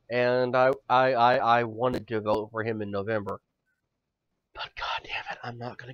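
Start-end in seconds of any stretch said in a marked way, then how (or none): 4.5-5.9 a hushed grumble under the breath; whispered aside. Real, not acted. Host confides his voting regret, whispering. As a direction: whisper it, half to himself.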